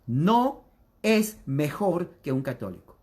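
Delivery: slow it right down so it sinks in; slow and deliberate. Shown as a demonstration. Preacher slowing down to drive the point home to evangelicals. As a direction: spell it out slowly, word by word. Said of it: "se lo voy a decir despacio".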